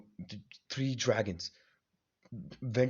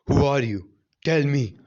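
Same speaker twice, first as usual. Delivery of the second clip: demanding shout; 2 semitones higher, 10 dB louder than usual. Acted. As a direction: bark the question at the stranger. Naruto demands answer.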